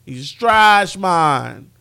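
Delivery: loud, drawn-out mock Jamaican accent. Host demos his island-show voice on Nick Foles, Jamaican accent. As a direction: proclaim it in a put-on Jamaican accent. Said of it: "the dude had a Jamaican accent".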